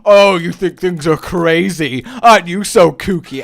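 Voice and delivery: loud, sing-song teasing voice; hammed-up playful taunt; mock kooky teasing. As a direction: tease like a goofy TV host. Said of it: "I don't know why I said it like that".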